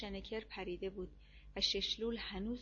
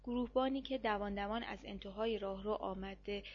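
usual speaking voice

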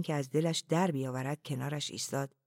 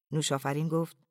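usual speaking voice

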